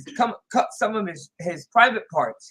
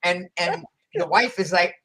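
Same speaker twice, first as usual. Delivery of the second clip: ordinary delivery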